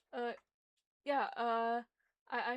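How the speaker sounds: ordinary delivery, nothing marked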